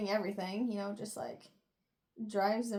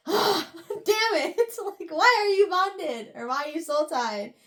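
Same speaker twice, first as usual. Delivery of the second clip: higher, louder, laughing exasperation; laughing frustration; 6 semitones higher, 6 dB louder than usual. Retold or imitated. [name] frustrated, laughing while retelling.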